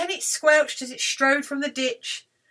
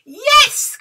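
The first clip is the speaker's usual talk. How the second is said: high, loud yes; delighted cry; 11 dB louder than usual. Acted. Witch sees magnificent broom, delighted cry. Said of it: "cried the witch".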